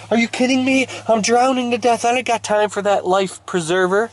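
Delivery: high-pitched frantic mock yell; panicked indignation. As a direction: protest like a swimmer going under. Drowning swimmer refuses rescue.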